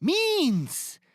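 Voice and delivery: exasperated correction. Acted. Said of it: "Did you say meats?".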